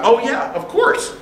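high-pitched, re-enacted quick concession; ready agreement. Student conceding class time to his teacher, readily agreeing. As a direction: give way at once, voice jumping up.

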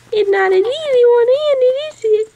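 high, loud cooing. Real, not acted. Cooing to baby.

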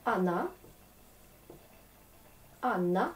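"Anna" with the final A said clearly as a; Russian pronunciation. Shown as a demonstration. Teacher models "Anna" for French learners of Russian. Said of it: "c'est pour ça que je prononce A".

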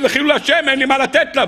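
high-pitched retold lament; retold tearful outcry. Jacob explains he has nothing to give her, mock tearful lament. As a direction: plead in shame, imitating Jacob. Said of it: "ופרץ בבכי".

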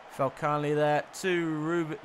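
ordinary delivery

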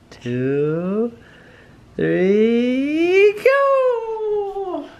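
drawn-out count climbing to a high whoop; building countdown. Real, not acted. Parent counting down to launch baby, building excitement. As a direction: stretch each word, rising into the launch.

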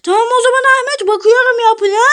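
ordinary talk, nothing marked

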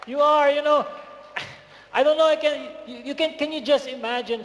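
excited preacher; fired-up preaching. Real, not acted. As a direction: lift the room with rising excitement. Preacher fires up.

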